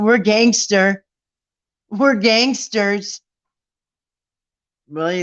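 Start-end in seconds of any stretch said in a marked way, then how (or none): 0.0-3.2 slow, drawn-out mock tough-guy boast, laughing into it; mock gangster boast. Mocking the trolls' bragging, slow mock tough-guy voice. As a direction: sneer their brag back at them.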